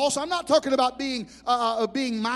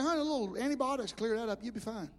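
soft, dismissive reassurance, much softer; reassuring; 3 semitones lower, 9 dB quieter, faster than usual. Retold or imitated. Pastor calming a frightened church lady, softly reassuring. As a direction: brush it off gently.

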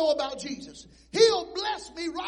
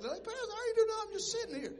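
innocent sound man; 8 semitones higher than usual. Retold or imitated. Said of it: "Mike's like".

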